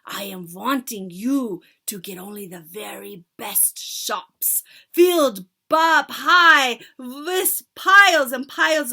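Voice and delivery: loud witch leader giving orders; commanding witch. Acted. Witch leader instructing her followers, loud. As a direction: command the crowd grandly.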